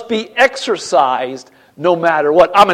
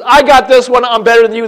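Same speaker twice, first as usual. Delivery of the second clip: raised, louder mimicked bragging; mock boasting; 6 semitones higher, 5 dB louder than usual. Corinthians flaunting spiritual gifts, mock boastful. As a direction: imitate a show-off crowing.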